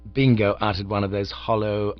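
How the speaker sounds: ordinary delivery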